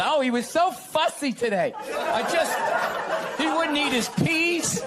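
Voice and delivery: high, mock-sweet mother fretting over her toddler; mock gushing mother. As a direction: lightly parody a doting mom.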